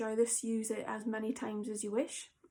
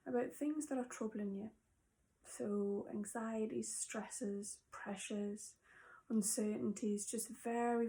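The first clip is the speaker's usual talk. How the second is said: guided meditation; 4 dB quieter than usual. Acted. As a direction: guide them gently into calm and gratitude.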